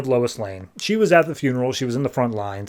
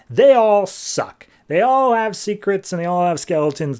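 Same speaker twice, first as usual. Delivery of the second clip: grumpy bartender; 7 semitones higher, 3 dB louder than usual. Retold or imitated. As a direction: sneer it like a fed-up barkeep. Bartender grumbling about heroes.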